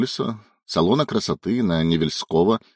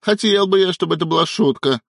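high, raised, exasperated grumble; grumbling; 8 semitones higher, 4 dB louder than usual. A narrator's reading. Husband heading for the storeroom after wife's teasing, grumbling. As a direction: grumble loudly in mild annoyance. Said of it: "проворчал Илья".

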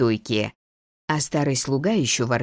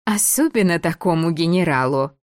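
лукаво; 4 semitones higher than usual. Acted. Девица дразнит.

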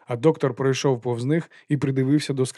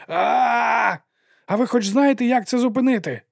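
very high, shouted panic; panicked shout; 10 semitones higher than usual. A narrator's reading. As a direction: scream the question in alarm. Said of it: "закричав Лобсанг".

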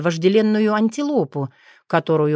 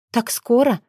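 dismayed; 5 semitones higher than usual. A narrator's reading. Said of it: "Я села прямо там".

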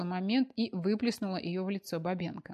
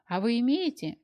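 flaring up; 4 semitones higher, 6 dB louder than usual. A narrator's reading. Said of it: "вскинулась Аня".

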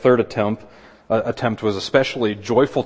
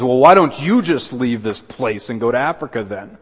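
indignant; 4 semitones higher, 8 dB louder than usual. Retold or imitated. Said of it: "in indignation to it".

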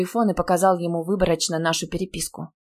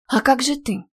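hurried concern; 7 semitones higher than usual. A narrator's reading. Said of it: "поспешила спросить я".

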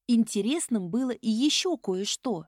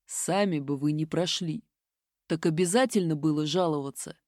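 low male voice; 6 semitones lower than usual. A narrator's reading. Mage repeats his reproach.

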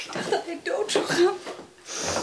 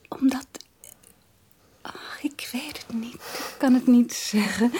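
intimate whisper; 3 semitones lower than usual. Acted. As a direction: murmur close to his ear. Intimate talk with fiancé. (verliefd fluisterend tegen haar verloofde)

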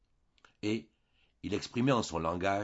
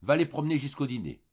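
higher, louder bossy command; brisk order; 7 semitones higher, 5 dB louder, faster than usual. A narrator's reading. Wife ordering her husband out, bossy and loud. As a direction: send him off briskly. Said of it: "en disant à son mari".

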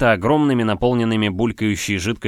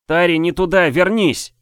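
high urgent shout to come back; 8 semitones higher, 5 dB louder than usual. Acted. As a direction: call out in alarm. AI calls from above.

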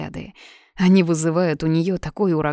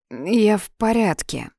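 tipsy, slowed and slurring; forcing words out through a drunken tongue; slurred drunk speech; 4 semitones higher than usual. A narrator's reading. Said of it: "выдавливает она заплетающимся языком".

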